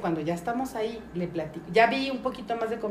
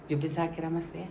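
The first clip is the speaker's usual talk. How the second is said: ordinary talk, nothing marked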